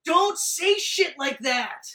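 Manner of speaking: loud, high, drawn-out wail; slow, pleading shout; mock protest. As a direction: cry out in exaggerated heartbreak at the line. Emotional retort to movie line.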